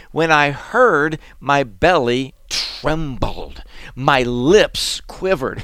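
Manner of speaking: slow, lingering scripture; slow dramatic rereading. Acted. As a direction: draw each phrase out for weight. Rereads verse slowly, dwelling.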